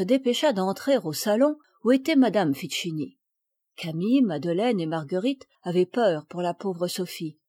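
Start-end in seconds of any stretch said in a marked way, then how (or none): none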